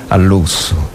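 ordinary talk, nothing marked

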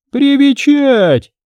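wife mocking her husband's word, shrieking. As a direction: spit his word back at him.

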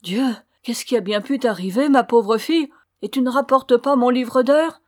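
rising to loud, high dismay; alarmed exclamation. A narrator's reading. Mother seeing her daughter return empty-handed, exclaiming. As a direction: cry out, shocked and reproachful. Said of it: "elle s'écria".

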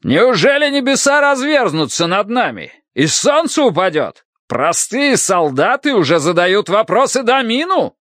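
high-pitched indignant tirade; incredulous, high, angry declaiming; outraged shouting. A narrator's reading. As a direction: rail at the insolent soldiers. Enraged minister rebukes questioning soldiers.

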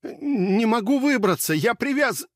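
high, gasping panic; breathless high cry; out of breath. A narrator's reading. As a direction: choke on the words, breathless. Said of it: "натурально задыхался".

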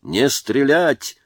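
high, drawn-out command; shouted order. A narrator's reading. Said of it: "крикнул Читаев". (протяжный командный выкрик)

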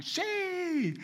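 put-on very high childlike shout of yes; children shouting yes. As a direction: squeal it like an eager child. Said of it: "los niños gritaban".

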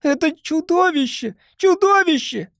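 put-on a young boy shrieking in terror; boy's ringing cry. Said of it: "прозвенел мальчишеский голос".

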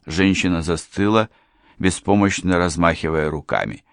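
ordinary delivery

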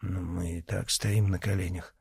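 soft, timid reply. A narrator's reading. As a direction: speak up nervously, barely. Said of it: "робко заметил".

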